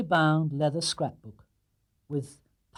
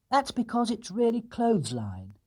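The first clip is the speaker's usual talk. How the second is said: higher, matter-of-fact bear; earnest bear; 9 semitones higher than usual. Acted. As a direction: explain it proudly. Paddington explains.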